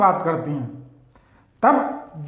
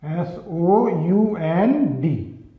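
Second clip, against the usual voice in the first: slow spelling; 3 semitones lower, 3 dB quieter, much slower than usual. Shown as a demonstration. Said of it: "ये short sound है".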